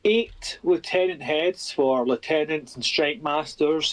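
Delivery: ordinary talk, nothing marked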